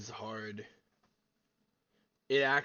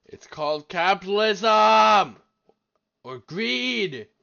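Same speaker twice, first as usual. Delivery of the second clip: loud, raised-pitch correction; shouted retort; 5 semitones higher, 9 dB louder, slower than usual. Real, not acted. Viewer answering the video on greed, shouted retort. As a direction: belt out the key word at the screen.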